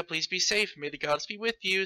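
ordinary talk, nothing marked

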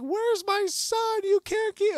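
very high, loud, slow mock shriek; hysterical yelling mom. Mom yelling through the party for her son, hysterical shriek. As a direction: wail it like a frantic mother storming a party. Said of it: "why is she yelling like".

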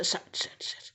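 very soft, low shushing; almost silent soothing; soft hushing. Real, not acted. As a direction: hush the pet down to sleep. Hushing the pet.

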